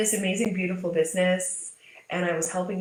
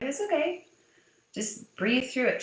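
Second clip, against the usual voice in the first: soothing mom; 6 semitones higher than usual. Retold or imitated. Mother soothing crying toddler.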